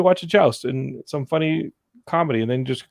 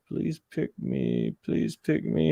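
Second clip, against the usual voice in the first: slow, hushed, drawn-out begging; creepy-slow imploring, stretched on each word; mock pleading; 11 semitones lower, 5 dB quieter, much slower than usual. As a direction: imitate a lovesick silent plea. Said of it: "just looking at her like".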